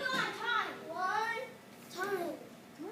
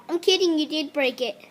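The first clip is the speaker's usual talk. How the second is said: ordinary talk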